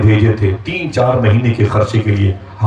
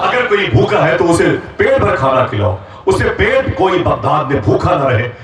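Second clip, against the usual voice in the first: higher, ringing call to the crowd; a preacher's raised call to feed the hungry, higher pitched; said with force; 6 semitones higher than usual. Shown as a demonstration. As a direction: proclaim it so the whole hall hears. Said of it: "کاش آپ زور سے کہتے".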